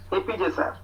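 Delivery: ordinary talk, nothing marked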